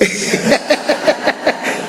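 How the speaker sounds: high, wheezy run of chuckles; rapid voiceless giggling; real laugh. Real, not acted. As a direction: laugh at your own joke. Laughs at hot dog joke.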